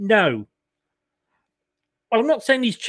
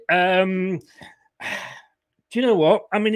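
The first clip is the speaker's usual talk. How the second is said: ordinary talk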